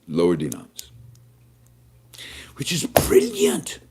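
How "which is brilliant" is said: breathy high whisper; whispered aside. Real, not acted. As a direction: let it slip as a sly whisper.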